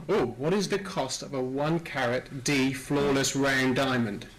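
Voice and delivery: ordinary delivery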